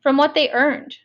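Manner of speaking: usual speaking voice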